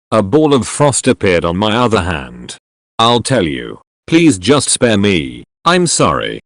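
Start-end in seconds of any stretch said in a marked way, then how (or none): none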